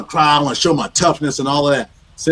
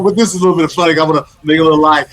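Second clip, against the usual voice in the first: imitating Reggie; 2 semitones higher, 5 dB louder, faster than usual. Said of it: "And I remember you saying".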